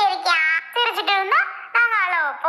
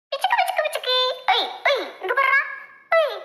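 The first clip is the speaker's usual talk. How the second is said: put-on high sing-song cooing at a baby; playful high baby talk; 6 semitones higher than usual. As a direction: coo high to catch the infant's eye.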